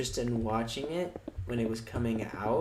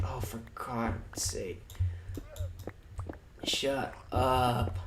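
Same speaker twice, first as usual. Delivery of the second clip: slow, whispered exasperation; fed-up whisper; 4 dB quieter, much slower than usual. Retold or imitated. Mocking his own reaction to fanboys, slow fed-up whisper. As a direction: sigh it out, totally done. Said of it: "I'm just like".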